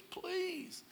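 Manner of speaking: put-on tiny, high, soft voice of a crying girl; retold tearful plea. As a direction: imitate a small pleading squeak. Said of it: "the tears just start pouring".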